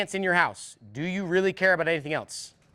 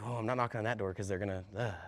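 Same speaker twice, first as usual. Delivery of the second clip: mock timid whine; 7 semitones lower, 12 dB quieter than usual. Mocking a timid rep.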